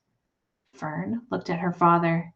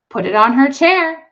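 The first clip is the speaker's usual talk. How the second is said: mother's voice; 7 semitones higher, 12 dB louder than usual. A narrator's reading. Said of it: "said Missus Arable".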